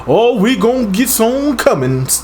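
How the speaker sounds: high, drawn-out mock movie line; mock slavery-film character. Mocking the slavery movies he can't watch, high caricature. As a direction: exaggerate it as a slow, high-pitched caricature.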